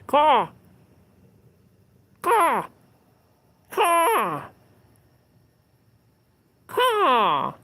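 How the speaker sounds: loud, high caw sliding down; bird caw. Shown as a demonstration. Streamer trying to rouse the thing in the tree, falling caws. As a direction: mimic a crow calling out.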